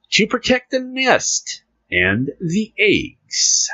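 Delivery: reading the news to the listeners, playful announcer; mock news read. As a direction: announce the story grandly, slowly. Reads news article aloud.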